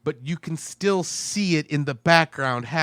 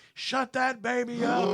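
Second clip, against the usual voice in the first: imitating the villain; 9 semitones higher than usual. Voicing the film's villain.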